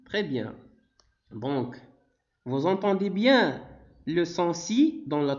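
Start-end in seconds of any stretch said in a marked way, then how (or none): none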